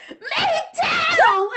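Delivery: high-pitched mock shriek of joy; retold excited squeal. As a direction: squeal it like a thrilled friend. Friend's excited reply, retold.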